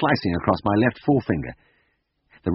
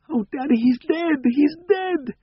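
put-on wailing child; slower than usual. Children crying over dead dog.

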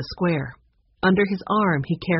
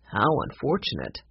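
lightly voiced grandfather, lower; a touch of regret in a lowered voice; mildly regretful grandfather; 5 semitones lower, 4 dB quieter than usual. A narrator's reading. Grandfather hears of missing music, mildly regretful low voice. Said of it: "shook his head slowly".

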